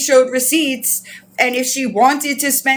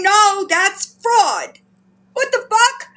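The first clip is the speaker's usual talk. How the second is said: high, indignant insistence; incredulous, high-pitched outrage; outraged protest; 6 semitones higher than usual. Real, not acted. Outraged retelling of own protest.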